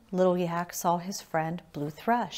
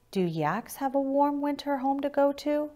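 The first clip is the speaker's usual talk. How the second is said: put-on higher, small child's voice; little yak voice; 8 semitones higher than usual. A narrator's reading. Little Yak asks Blue Thrush about a warm home, in a higher child's voice. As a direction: ask it as an eager young calf.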